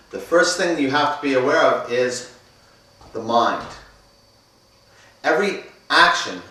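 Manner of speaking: loud, projecting class delivery; instructor voice. Acted. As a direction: address the class with energy. Leads the introductory class.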